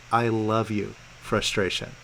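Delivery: low, loving murmur to an emotion; tender affirmation. Shown as a demonstration. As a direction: say it gently and low, as if to soothe the feeling. Models loving words to frustration.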